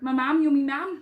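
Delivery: playful amateur Yorkshire voice; Yorkshire impression. Shown as a demonstration. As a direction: ham up a Yorkshire accent. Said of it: "my Yorkshire impression".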